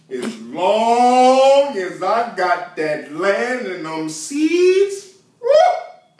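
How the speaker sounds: drawn-out, loud, climbing to a high whoop; gleeful crowing. Acted. Boy Willie dreaming of land and seed, exultant. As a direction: stretch the words out and burst into a whoop.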